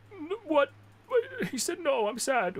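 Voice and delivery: high, whiny voice; pretend-sad fan; whiny sad viewer.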